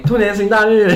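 loud, high festive wish; cheerful new year wishes. Acted. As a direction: shout the lucky wish. New year greetings on podcast.

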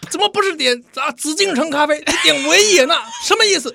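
high, loud, mock interrogation; Red Guard interrogator. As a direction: grill them like a zealous Red Guard. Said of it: "这是红卫兵啊". (红卫兵审问腔)

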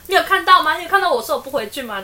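wife shouting at her silent husband, hammed-up indignant yell; mock shout. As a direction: yell it like a spoiled wife who's being ignored.

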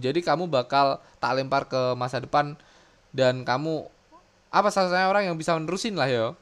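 ordinary talk

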